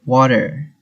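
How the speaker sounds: American water, tapped T and R-coloured ending, falling; US accent. Shown as a demonstration. Teacher echoing the played US water, American accent. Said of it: "water in U S accent".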